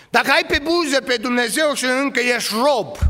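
loud, high warning cry; preacher's shout. Acted.

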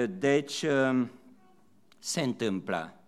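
ordinary talk, nothing marked

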